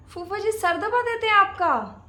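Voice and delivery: loud, high-pitched disbelief; astonished question. Acted. Bahu hears uncle massages aunt's head, astonished question.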